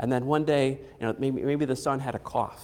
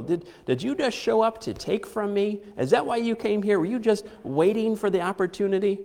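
accusing; 7 semitones higher than usual. Retold or imitated. Grieving mother accusing the prophet.

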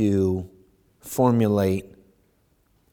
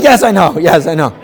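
louder, higher weary yes-I-know; resigned reply; 5 semitones higher, 11 dB louder than usual. Retold or imitated. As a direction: brush it off, tired of hearing it. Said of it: "He's like".

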